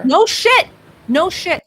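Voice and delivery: loud, high no shit, then easing down; scoffing shout. Real, not acted. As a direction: yell it back at the screen in disbelief. Scoffing loudly at clip.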